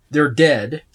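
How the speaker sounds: normal talking voice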